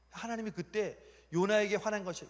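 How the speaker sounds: usual speaking voice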